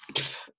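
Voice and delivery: short sniff in imitation of the dog; imitated dog sniff. Dog owner showing how her dog refused tea, imitated sniff. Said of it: "And she went".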